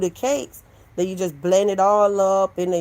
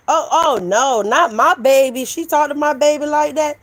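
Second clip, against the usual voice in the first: mock outrage; 8 semitones higher, 6 dB louder than usual. Mocking the outraged mother.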